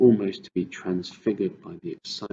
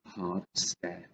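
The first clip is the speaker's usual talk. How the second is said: ordinary delivery, nothing marked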